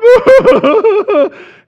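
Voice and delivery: loud, high fake sobbing wail; hammed-up boohoo; mock uncontrollable crying. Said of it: "uncontrollably crying".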